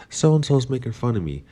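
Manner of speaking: mock a child coming home in tears; imitating a crying kid. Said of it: "crying and goes".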